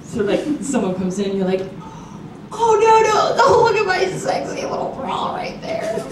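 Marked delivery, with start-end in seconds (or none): 2.5-6.0 high, drawn-out mock embarrassment; mock coy protest. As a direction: feign alarm in a high, slow, girly voice. Mock coy act of embarrassed girls.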